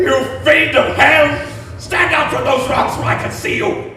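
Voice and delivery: furious challenge. Acted. Frankenstein confronting the monster on the rocks, furious shout. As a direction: hurl it at him.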